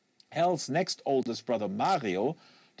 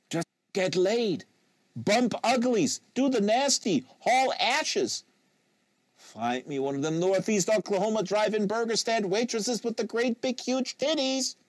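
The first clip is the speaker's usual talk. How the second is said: lusty rant; 9 semitones higher than usual. A narrator's reading. Lusty rant about getting laid.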